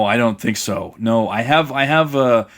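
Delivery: ordinary delivery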